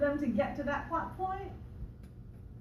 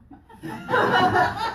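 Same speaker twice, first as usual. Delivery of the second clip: laugh; 9 semitones higher, 11 dB louder than usual. Real, not acted. Laughing at her own story.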